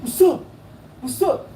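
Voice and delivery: high mock challenge in short bursts; imitated fight challenge. Shown as a demonstration. Showing how the rival squared up, high imitated challenge. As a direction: demonstrate a would-be fighter's taunt. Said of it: "Like this, watch".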